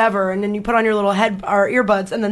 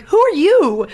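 high-pitched comeback echoing the question; mock-indignant echo; retold indignant retort; 8 semitones higher, 3 dB louder, much slower than usual. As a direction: throw it back at him, pitched well up. Driver throws the question back.

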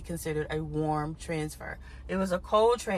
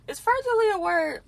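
jumps much higher, half laughing at herself; mock disbelief; 14 semitones higher, 5 dB louder than usual. Real, not acted. Wondering if her made-up word is real, mock high squeak.

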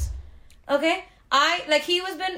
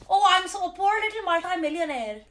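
higher, whiny mock pity; mock self-pity; 6 semitones higher than usual. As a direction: parody a rich woman's sob story. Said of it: "you're telling us".

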